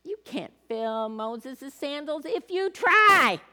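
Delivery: mocking, slow taunt, a touch of Satan; whispering Satan. Satan taunting Joshua in the loop, lightly voiced mocking whisper. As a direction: sneer the doubt at him slowly. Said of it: "Satan was whispering things to him".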